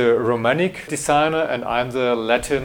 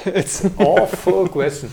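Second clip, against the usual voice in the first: laughing through the words, higher and louder; 7 semitones higher, 4 dB louder than usual. Real, not acted. Designer laughing off the interviewer's question about being remembered. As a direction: laugh it off, a bit embarrassed.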